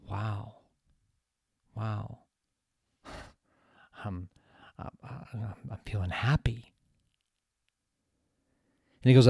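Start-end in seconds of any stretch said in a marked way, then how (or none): 0.1-6.7 soft, halting, near-whispered realization; imitated hushed wonder. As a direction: act out quiet amazement, searching for words. Acts out Ken's dawning happiness.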